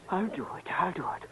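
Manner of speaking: hushed, breathy promise under his breath; nervous whisper. Acted. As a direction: whisper it so the men in the car barely hear. Nervous accomplice agreeing before the job.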